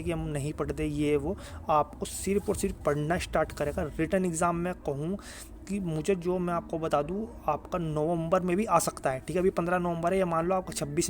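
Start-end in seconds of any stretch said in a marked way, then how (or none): none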